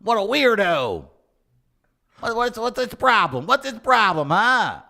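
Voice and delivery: a hint of New York accent; tossed-off New York accent gripe. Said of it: "your New York accent there".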